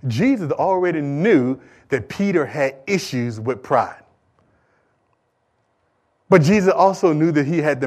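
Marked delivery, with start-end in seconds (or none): 0.1-4.0 slow, low-key and measured; slow deliberate restatement. Real, not acted. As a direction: bring the point round slowly. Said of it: "bring this thing back around for you".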